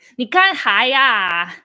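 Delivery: loud, scolding Dongbei auntie; Dongbei accent. Shown as a demonstration. Showing viewers Dongbei dialect, loud scolding Dongbei accent. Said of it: "And then the Dongbei accent".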